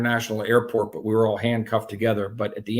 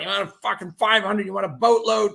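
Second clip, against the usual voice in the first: high, pushy mock of the father offering more; imitating bragging dad; 7 semitones higher, 4 dB louder than usual. Son mimics his dad boasting to friends, high mock brag. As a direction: puff it up like a braggart dealing kilos. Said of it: "He's talking like this".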